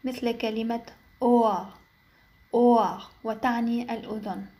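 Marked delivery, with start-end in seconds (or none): 1.2-3.0 German "أوار" with a silent h after long o; light h. Shown as a demonstration. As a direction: say "أوار" as "oh-a", h barely there. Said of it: "تنطق خفيفة". ("أوار" مع هاء خفيفة)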